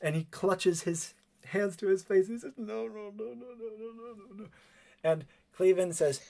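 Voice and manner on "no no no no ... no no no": soft, high repeated pleading; high, hushed panic; terrified whimpering. Acted. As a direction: whimper it over and over, fading away. Man cowering under desk in fear.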